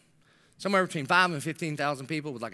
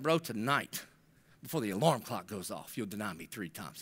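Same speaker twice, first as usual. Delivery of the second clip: low, softer warning of denial; quiet warning; 10 semitones lower, 9 dB quieter than usual. Retold or imitated. Jesus quietly warns Peter he will deny him. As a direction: lean in and warn him gently.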